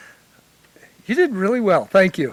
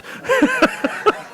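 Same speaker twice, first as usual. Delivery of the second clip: real burst of laughter. Real, not acted. Laughing at his own story.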